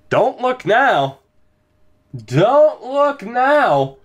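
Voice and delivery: high, drawn-out crowing; triumphant teasing. Real, not acted. Announcing a forced game six, triumphant drawn-out tease. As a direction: stretch each word high and slow, crowing.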